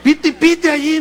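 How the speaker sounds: loud, high mock tooting with a laugh; mock horn toot. Preacher mimics brothers blowing horns, loud tooting.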